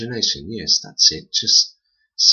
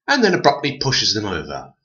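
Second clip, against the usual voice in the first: usual speaking voice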